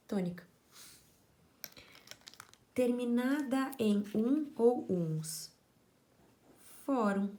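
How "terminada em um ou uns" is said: high, slow and deliberate; slow emphatic reading. Shown as a demonstration. Teacher drawing out the endings for the class, slow and emphatic. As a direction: spell out each ending slowly. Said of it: "teacher reads ending aloud".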